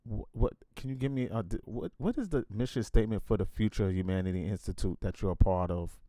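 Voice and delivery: ordinary talk, nothing marked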